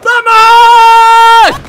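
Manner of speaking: loud rising victory yell; victory shout. Real, not acted. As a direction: scream out the win. Celebrating a kill.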